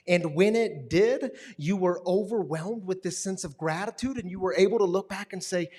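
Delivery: loud, driving preacher build to the congregation; preaching at full force. Acted. Pastor preaching to the congregation about rescue, loud and driving. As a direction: preach it out, rising and pressing.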